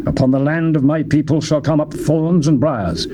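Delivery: usual speaking voice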